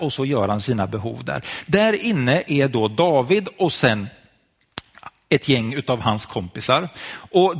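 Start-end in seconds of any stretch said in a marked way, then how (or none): none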